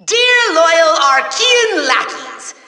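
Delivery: ordinary delivery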